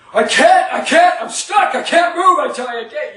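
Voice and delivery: stuck man shouting to his rescuer in full panic; panicked shout. Retold or imitated. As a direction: yell like you're trapped and can't move. Ryan panicking in the tunnel.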